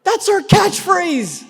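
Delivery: high, slow shouted catchphrase; big comic shout. Acted. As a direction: belt it out like a catchphrase.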